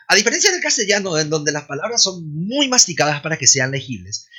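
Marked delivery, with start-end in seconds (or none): none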